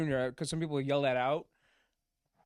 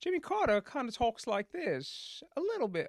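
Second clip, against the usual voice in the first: Jimmy Carter; 8 semitones higher, 3 dB quieter than usual. Shown as a demonstration. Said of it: "a bit of a high-pitched voice".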